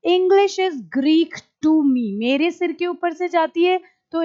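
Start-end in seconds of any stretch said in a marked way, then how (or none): 0.1-2.2 slow, careful teaching read; model sentence, slow. Shown as a demonstration. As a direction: say it slowly so students can repeat. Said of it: "आप बोलेंगे".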